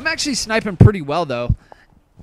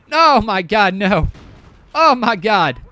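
loud, raised "oh my God, no"; real groan of dismay at a blown shot, out loud; frustrated outburst; 4 semitones higher, 8 dB louder, slower than usual. Real, not acted. Missing an easy snipe on stream, loud dismay. Embarrassing missed snipe in game.

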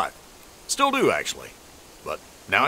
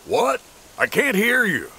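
raised, higher shout; shouting over machinery; 8 semitones higher than usual. Acted. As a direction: yell to be heard over noise. Shouting down from the tree.

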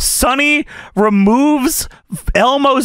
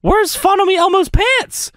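high-pitched puppet question; Elmo; 10 semitones higher than usual. Acted. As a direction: ask it in squeaky Elmo voice. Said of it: "looks down at his crotch and says".

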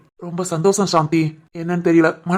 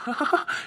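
soft chuckle; 6 semitones higher, 11 dB quieter than usual. Acted. Husband after confession.

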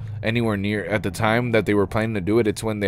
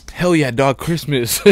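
mock-hyped kid, higher and louder; 5 semitones higher, 4 dB louder, slower than usual. Man retells his childhood Christmas hype, mock excited. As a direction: ham up a kid's Christmas excitement. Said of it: "I was so hyped for Christmas. I was like".

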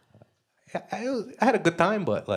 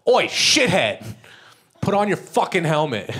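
loud, high Aussie bark at a scooter rider; Australian cop yell; 7 semitones higher, 7 dB louder, slower than usual. Retold or imitated. Australian cop yelling at a helmetless scooter rider. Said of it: "he just rolled down his window and went".

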